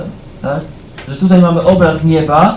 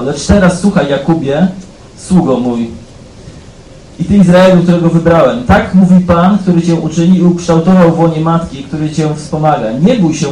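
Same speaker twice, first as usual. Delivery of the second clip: louder, proclaiming Bible reading; solemn scripture reading; 4 dB louder than usual. Acted. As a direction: declare the prophet's words to the hall. Reads Isaiah aloud forcefully.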